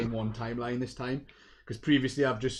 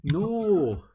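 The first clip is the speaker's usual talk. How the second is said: outraged disbelief; 10 dB louder, slower than usual. Real, not acted. Friend never saw the film.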